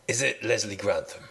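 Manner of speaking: a country contestant greeting the host; West Country accent. Pretend. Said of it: "I'll talk like a West Country man then".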